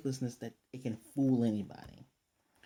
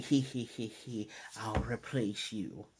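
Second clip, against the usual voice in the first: mock villain laugh; 3 semitones lower, 4 dB quieter, slower than usual. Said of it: "outside laughing".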